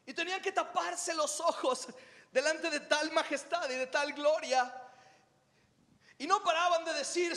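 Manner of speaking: breathless prophet spilling out what he saw, pitched high; high, wide-eyed telling of the throne vision; Isaiah telling it. Acted. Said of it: "Isaías de repente estaba ahí parado y dijo".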